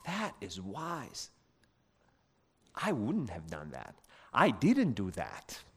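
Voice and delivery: soft, slow musing to oneself; quiet reflection. Retold or imitated. Parent thinks over another parent's wisdom, quietly humbled. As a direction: think it aloud, low and hushed. Retold inner thought of admiration.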